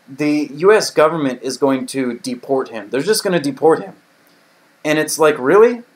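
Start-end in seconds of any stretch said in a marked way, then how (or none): 5.4-5.8 high, loud disbelieving really?; incredulous really. Real, not acted. Vlogger scoffing at the US deportation decision, incredulous. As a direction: scoff at the idea, pitched up and louder.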